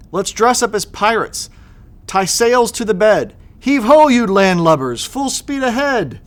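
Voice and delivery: hammed-up pirate, high at the end; mock pirate call. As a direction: play captain to the landlubbers. Kids playing pirates.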